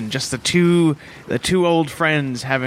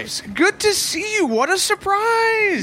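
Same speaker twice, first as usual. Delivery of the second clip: hammed-up surprise; slower than usual. Greeting the boat captain.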